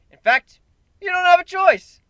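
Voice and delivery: high, loud, pumped-up crowd call; rally them hard; excited insistence. Acted. Narrator on stage forcing the crowd, excited.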